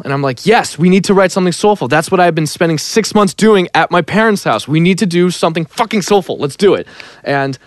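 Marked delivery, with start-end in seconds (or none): 0.5-6.9 high, eager retold outburst; fired-up agreement. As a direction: rush into it, all in. Acting out his fired-up reply.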